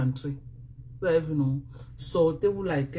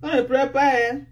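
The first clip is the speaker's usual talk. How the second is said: taunting; 6 semitones higher than usual. Real, not acted. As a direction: draw out the mock command. Taunting opponents not to cry.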